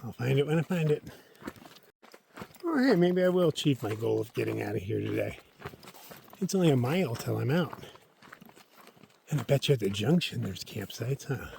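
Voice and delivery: normal talking voice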